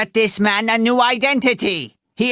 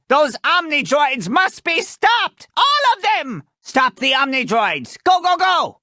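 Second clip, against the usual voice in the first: high, emphatic rallying cry; urgent command; 8 semitones higher than usual. Acted. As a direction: send them into battle, pitch soaring. Urgent orders against attacking robots.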